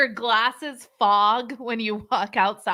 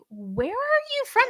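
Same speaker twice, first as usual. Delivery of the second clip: retold puzzled question; 13 semitones higher than usual. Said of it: "I'm like".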